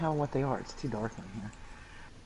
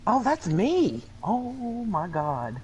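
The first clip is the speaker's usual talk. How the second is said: delighted surprise; 9 semitones higher, 4 dB louder, much slower than usual. Real, not acted. Finds her own photo.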